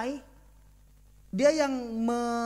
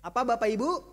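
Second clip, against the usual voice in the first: ordinary delivery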